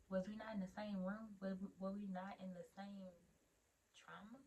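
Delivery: very soft retold protest; muted, baffled question; hushed disbelief. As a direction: ask it under your breath, incredulous. Retold disbelieving reply, under breath.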